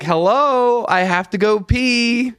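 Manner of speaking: high-pitched, drawn-out call for whoever's inside; retold holler through a bathroom door. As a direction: call out plaintively to someone behind a door. Calling through a door he's knocking on.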